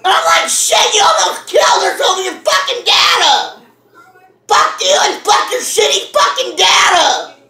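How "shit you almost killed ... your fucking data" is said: ordinary talk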